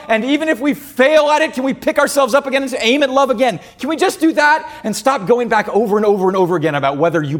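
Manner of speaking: high, loud, fed-up yelling at a spouse; exasperated shout. Retold or imitated. Spouse across the table, fed up with the question: exasperated shout.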